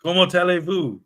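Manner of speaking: mock French accent. Tries French after being corrected.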